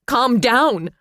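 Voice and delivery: high, loud shout. A narrator's reading. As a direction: throw it back at him. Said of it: "she shouted".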